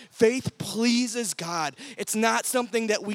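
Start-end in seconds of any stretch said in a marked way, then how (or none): none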